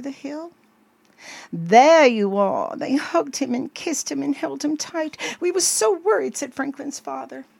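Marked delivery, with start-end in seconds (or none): 1.5-2.8 loud, drawn-out cry of relief; relieved exclamation. A narrator's reading. Parents find lost child. 5.4-6.4 high, louder anxious father; worried relief. A narrator's reading. Father relieved at finding his child.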